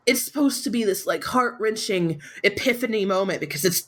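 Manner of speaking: normal talking voice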